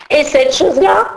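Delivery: high, loud scolding teacher voice; teacher scolding the late fairy, high and loud; stern schoolmistress. Acted. Teacher inspecting.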